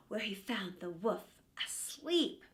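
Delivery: ordinary delivery, nothing marked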